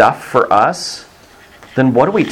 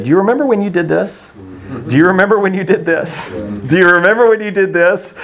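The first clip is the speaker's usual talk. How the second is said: high, knowing cop repeating the jab; teasing cop; 9 semitones higher than usual. Retold or imitated. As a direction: needle him with it, much higher. Cop reminding father.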